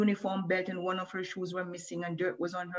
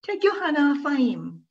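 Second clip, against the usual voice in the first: put-on high, loud, firm girl's warning; large girl's voice; 9 semitones higher, 7 dB louder than usual. A narrator's reading. Large girl defending Moshe from the bully, high and firm. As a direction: stand up to him, protective. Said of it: "in the large girl's voice".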